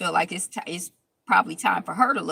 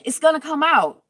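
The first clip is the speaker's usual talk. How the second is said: mock loud insistence; 9 semitones higher, 8 dB louder than usual. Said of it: "Tam Tam is like".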